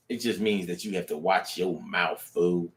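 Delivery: quiet muttered punchline; low muttered aside. Shown as a demonstration. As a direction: say it under your breath. Quiet first take of punchline.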